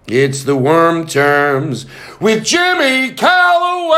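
big announcer intro, slow then soaring; show announcer. Shown as a demonstration. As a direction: announce the show title grandly. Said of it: "You're gonna love it".

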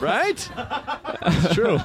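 a high laughing "Right?". Real, not acted. Host teases the guest about Frasier, laughing. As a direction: laugh it out, teasing.

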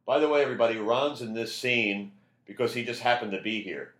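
loud announcement to the whole set; yelling to everybody. Retold or imitated. Director announcing to cast and crew, mildly yelled. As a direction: call it out over the room. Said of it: "just yells to everybody".

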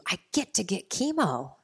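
lifted, eager reframing; glad inner dialogue. Retold or imitated. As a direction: say it as a welcome privilege, stress on GET. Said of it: "switched my inner dialogue".

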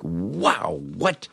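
ordinary delivery

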